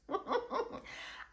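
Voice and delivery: airy high laughter; breathy high laugh. Real, not acted. Vlogger laughs picturing herself refusing to help, airy and high. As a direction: laugh at yourself lightly.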